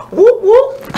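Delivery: two whoops, the second leaping high; high-pitched echo of a whoop; imitated whoop whoop. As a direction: mimic whoops heard from across the rooftops. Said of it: "all you heard was".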